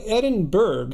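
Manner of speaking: "Edinburgh" ending in a hard "burg"; American-style "Edinburgh", hard G at the end; American spelling pronunciation. Shown as a demonstration. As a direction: say "Edinburgh" as it is spelt, EDIN-BURG. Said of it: "looks like when you read it in, you know, English".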